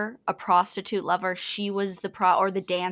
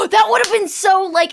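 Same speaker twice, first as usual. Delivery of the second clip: sharp gasp, then high and loud; thrilled, jumping in high and loud; excited gasp; 11 semitones higher, 10 dB louder than usual. Real, not acted. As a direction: gasp of delight at the idea. Excited reaction to a plot idea.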